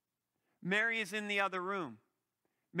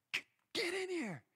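urgent summons; 11 semitones higher, 3 dB quieter than usual. Acted. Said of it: "Martha's like".

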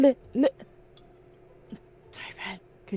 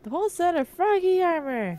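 incredulous; 11 semitones higher than usual. Real, not acted. Reads the item name in mock disbelief.

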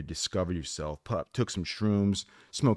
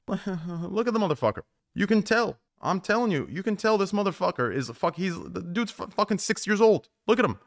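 incredulous amused outburst; 10 semitones higher than usual. Real, not acted. Streamer mocking his childish emote to chat, amused high disbelief. Streamer laughing at avatar's age.

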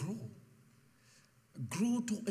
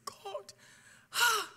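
crying out; 13 semitones higher, 3 dB louder than usual. Retold or imitated. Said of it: "I was crying and crying".

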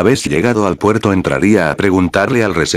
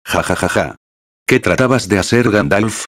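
light mocking laugh, then a taunt; a hint of mockery toward the fallen hero. A narrator's reading. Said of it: "con un tono de burla". (burla ligera, risa socarrona)

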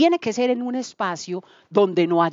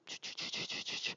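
whispered chu chu gossip sounds; breathy mock whisper of gossip; hushed gossip; 22 dB quieter than usual. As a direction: hiss it like secret gossip.

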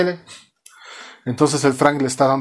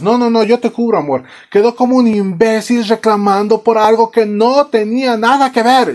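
high, louder, unbroken tirade; mock nagging rant; 9 semitones higher, 5 dB louder than usual. Wife covers for husband by berating him, mock ranting. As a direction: play a wife piling on, hammed-up.

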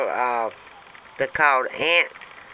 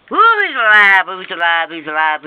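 high, loud burst, then settling; excited shout; 4 semitones higher, 10 dB louder than usual. Real, not acted. Finding the bug alive, excited shout. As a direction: blurt it out in real surprise.

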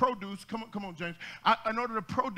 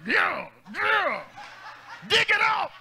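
imitated shout; 10 semitones higher, 5 dB louder, slower than usual.

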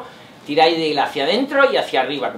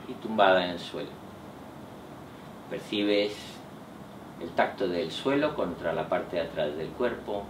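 guided meditation; 4 semitones lower, 7 dB quieter than usual. Acted. Leads a relaxation meditation.

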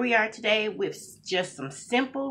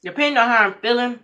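talking between bites; chewing while talking; 6 semitones higher, 9 dB louder, faster than usual. Real, not acted. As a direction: mouth partly full of noodles. Seen 1: Lips pressed and jaw working early on, then plain speech.

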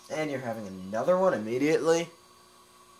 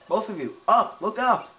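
calling out; 9 semitones higher, 8 dB louder than usual. Acted. Reading character's urgent call.

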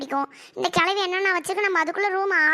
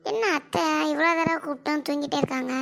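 ordinary talk

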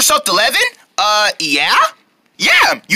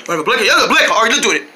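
ordinary delivery

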